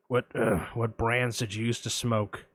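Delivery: lurk low like a shady caller; creepy caller. Retold or imitated. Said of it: "I'm gonna play both parts".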